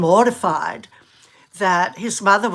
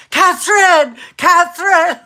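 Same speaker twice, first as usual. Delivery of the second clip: high, loud shout of a name; imitating a shout; 9 semitones higher, 7 dB louder than usual. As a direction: mimic a friend hollering at the princess. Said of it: "yelling out at Catherine".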